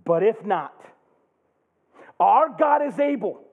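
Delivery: high, loud declaration of faith; defiant Hebrews. Acted. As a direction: defy the king boldly. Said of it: "they say this".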